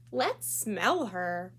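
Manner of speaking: very slow, sneering put-on voice; slow mocking. Classmate Jo jeering at Chrysanthemum, very slow and sneering. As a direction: drag it out to tease.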